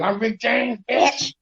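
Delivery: high mock [name] catchphrase; tossed-off [name] line; [name] impression. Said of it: "Remember [name] said".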